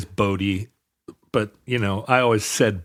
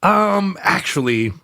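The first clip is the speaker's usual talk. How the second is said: mock pedantic um, actually; nitpicky correcting tone; pedantic correction; 7 semitones higher, slower than usual. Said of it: "looking over your shoulder going".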